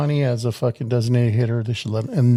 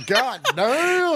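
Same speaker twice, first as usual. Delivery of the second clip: excited; 13 semitones higher than usual. Real, not acted. Shocked exclamation at size.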